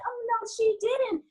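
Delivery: high, punchy sassy catchphrase; sassy oh no she didn't. Retold or imitated. Actress recreating her ad-libbed Martin line, sassy and high. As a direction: toss it off with sass. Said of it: "I added that line and I said".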